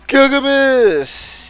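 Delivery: high, slow, loud name call; dramatic drawn-out announcement. Acted. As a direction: stretch the name out grandly.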